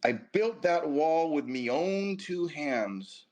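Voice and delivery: slow, high Scottish brogue; drawn-out put-on Scottish accent. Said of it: "I had the perfect Scottish accent".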